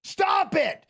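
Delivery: sudden high shouted stop; high mocking yell of stop it; mock commentator yell. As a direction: bark it like a panicking commentator.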